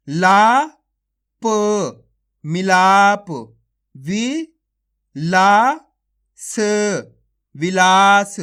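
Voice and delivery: stretched-out syllable calling, each syllable punched; phonics drill. Shown as a demonstration. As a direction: sound out each syllable slowly for children, then join them. Sounding out syllables into words.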